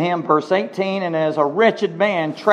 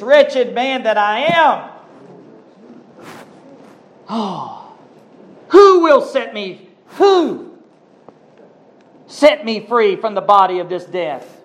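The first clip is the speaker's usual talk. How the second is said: high, slow, pleading lament; voicing Paul's anguished plea for rescue; anguished cry; 5 semitones higher, 3 dB louder than usual. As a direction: cry it out as Paul in agony. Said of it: "You can almost just feel him".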